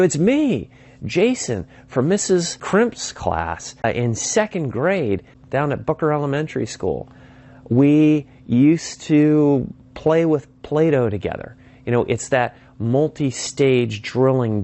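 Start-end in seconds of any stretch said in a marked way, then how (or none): 0.0-11.5 high, eager self-introduction; eager reminder. Acted. Speaker reminds forgotten kindergarten classmate, eager and hopeful.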